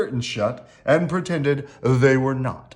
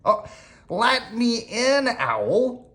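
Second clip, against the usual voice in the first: lightly voiced rabbit calling out, higher and drawn out; a hint of a rabbit calling, raised pitch; 9 semitones higher, slower than usual. A narrator's reading. Rabbit calls to Owl to be let in out of the storm, lightly voiced calling. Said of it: "called Rabbit".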